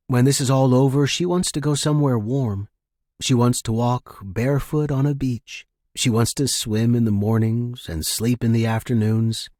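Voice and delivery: ordinary delivery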